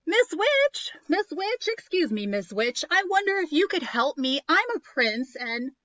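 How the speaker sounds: high, loud calling. Acted. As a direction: call out politely to the witch. Prince calling to the witch.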